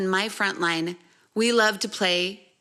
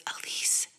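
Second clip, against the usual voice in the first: whisper; 9 dB quieter than usual. Retold or imitated. Husband whispering to her.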